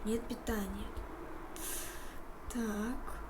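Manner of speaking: ordinary talk, nothing marked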